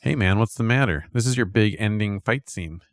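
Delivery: ordinary talk, nothing marked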